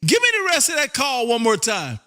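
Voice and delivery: ordinary talk, nothing marked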